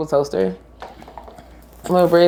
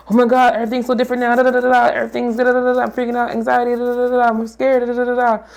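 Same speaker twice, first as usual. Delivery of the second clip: much higher, rushed mock panic; panicked self; 8 semitones higher, much faster than usual. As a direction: act out spiraling panic. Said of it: "versus like".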